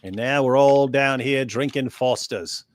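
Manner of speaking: broad mock Aussie accent; Australian accent. Reading Chloe's comment on request, bad Australian accent. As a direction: read it with a put-on Australian twang. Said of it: "Can you do an Australian accent".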